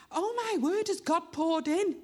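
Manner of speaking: high, overcome exclamation; emotional. Real, not acted. Preacher testifying about answered prayer, emotional. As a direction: marvel with a full heart, pitched high. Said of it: "that's why I'm emotional about it".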